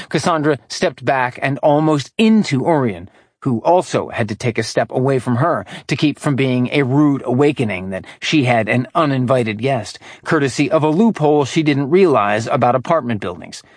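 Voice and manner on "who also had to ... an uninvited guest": usual speaking voice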